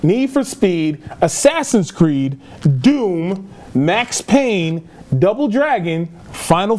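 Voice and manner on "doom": normal talking voice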